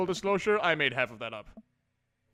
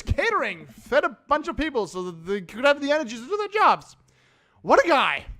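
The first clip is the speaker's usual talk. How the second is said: higher, louder earnest thanks to the crew; earnest gushing; 5 semitones higher, 5 dB louder than usual. Acted. [name] thanking each crew member in the credits, higher gushing voice. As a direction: gush over every name like a proud fan.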